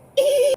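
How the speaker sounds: high mocking cackle; imitating a classmate's mocking laugh; stupid laugh. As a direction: ham up a dumb laugh.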